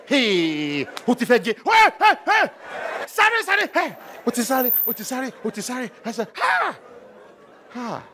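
very high, loud shrieks at the chase; hammed-up panicked outcry; mock frantic viewer. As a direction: scream at the screen as if the zebra were family. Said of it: "that's how some of you are".

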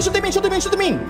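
ordinary talk

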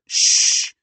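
loud whispered shush. Acted. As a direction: shush them harder, sharp and urgent. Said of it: "Sadie shushed louder".

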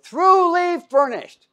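lifted, ceremonial verse reading; grand intoned reading. Acted. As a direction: sing out the words like a proclamation. Emphasizing the verse.

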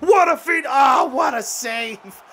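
very high, loud, slow cry of amazement; amazed shout. Real, not acted. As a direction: shout it like you can't believe the save. Amazed at a goalie save.

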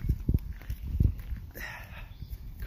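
long weary sigh out; heavy sigh. Real, not acted. Sick man sighing to his viewers. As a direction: let out a tired, heavy sigh.

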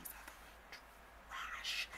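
soft, drawn-out whisper; whispered insult. Real, not acted. Describing the mama's boyfriend, whispered insult. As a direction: drop to a whisper on the word.

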